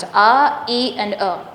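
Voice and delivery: each vowel of "a, e, and a" sounded on its own; separated vowel sounds. Shown as a demonstration. Teacher splitting a triphthong for the class, separated vowel sounds. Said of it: "There are three sounds".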